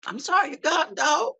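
high-pitched hammed-up grieving wail; mock mourning. Mock grief leaning over casket.

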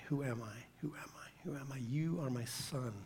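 very soft, almost whispered self-questioning; hushed inner questioning. Acted. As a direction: murmur it as a private reminder. Voicing Jesus's inner questioning.

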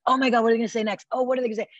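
eager friend. Retold or imitated. Eager friend hanging on words.

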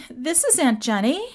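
a hint of a dismayed young girl; [name]'s impression. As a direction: lightly voice a girl in disbelief. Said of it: "I'm gonna be doing some impressions".

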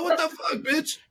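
hammed-up disbelief, pitched high; mock exasperation. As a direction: squeak out a mock "are you kidding me". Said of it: "I'm like".

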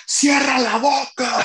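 grito agudo y lento de maestra regañona. Retold or imitated. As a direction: imitando a la maestra Irma gritando enojada, muy aguda y lenta. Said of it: "y Irma". (la maestra Irma le grita a Manolo que se calle, grito agudo y lento)

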